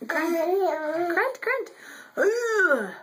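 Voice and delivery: put-on short playful grunts for the baby; demo grunts. Shown as a demonstration. Mom showing baby how to grunt, playful grunts. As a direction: grunt it out so he can copy. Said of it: "You do like I do".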